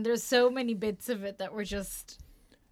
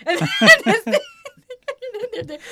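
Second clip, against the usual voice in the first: laughing; 12 semitones higher, 12 dB louder, much slower than usual. Real, not acted. Laughing hard at memory.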